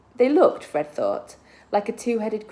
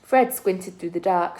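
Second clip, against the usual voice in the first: usual speaking voice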